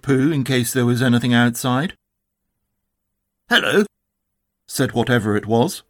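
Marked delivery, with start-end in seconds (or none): 3.5-3.9 high, bouncy Tigger hello. A narrator's reading. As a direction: pitch it up like Tigger. The strange animal answers back.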